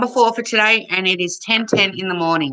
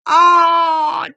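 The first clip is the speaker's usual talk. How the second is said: emotional; 7 semitones higher, 5 dB louder than usual. Real, not acted. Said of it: "I'm a bit emotional right now".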